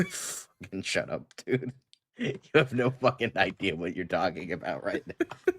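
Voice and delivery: ordinary talk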